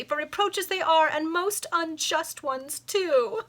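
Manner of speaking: high, slow, tearful lament; hammed-up sobbing complaint; weeping protest. A narrator's reading. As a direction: drag it out through tears. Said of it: "now weeping bitterly".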